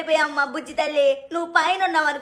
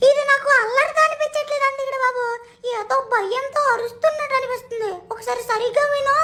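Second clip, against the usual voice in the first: much higher, squeaky cartoon sidekick, worried; high cartoon buddy voice, anxious; cartoon robot cat voice; 10 semitones higher than usual. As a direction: pitch way up for the sidekick, uneasy. Second character voiced high.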